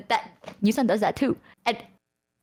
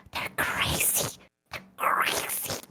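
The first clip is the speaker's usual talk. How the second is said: slow, hushed whisper; 7 dB quieter, slower than usual. Acted. Telling listeners about the friend, slow whisper. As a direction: whisper it as a stunned confession.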